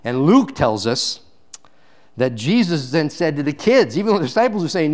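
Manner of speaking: normal talking voice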